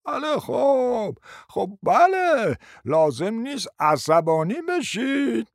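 slow, hesitant mumble; hesitant agreement. Acted. Timid man echoing the others slowly. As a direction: drag out a timid yes. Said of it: "امیر تایید کرد".

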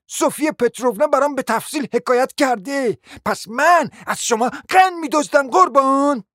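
high, scolding indignation; offended lady. Acted. Lady refusing to leave over gossip, indignantly scolding.

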